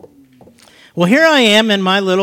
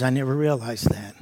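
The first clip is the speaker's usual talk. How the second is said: much softer, subdued imitation of her; hushed retelling of a moved woman; tearful woman, retold; 3 semitones lower, 7 dB quieter than usual. Crying woman in the repair van, softly retold. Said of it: "I'm hearing Nancy crying".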